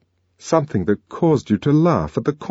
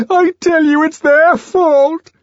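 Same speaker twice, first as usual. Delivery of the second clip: shrill, loud sobbing plea; 8 dB louder, slower than usual. A narrator's reading. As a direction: sob out the blame slowly. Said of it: "he sobbed".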